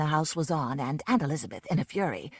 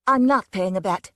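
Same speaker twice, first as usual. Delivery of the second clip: each word clearly separated, a bit louder; distinctly; 8 dB louder than usual. Acted. Susan correcting the annoyed man at the window, distinct and firm. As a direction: spell it out firmly. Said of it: "Susan said distinctly".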